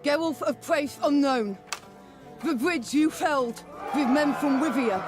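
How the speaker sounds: r turned to w, lisped; lisp from bad tooth. Knighting a hero despite a bad tooth, lisping. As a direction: proclaim it through a lisp, slurring r and s. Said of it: "because of my tooth".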